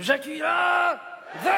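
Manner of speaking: high, drawn-out showbiz shout; star's proclamation. Acted. Puppet declaring himself the new star, shouted announcer-style. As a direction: announce yourself with long stretched syllables.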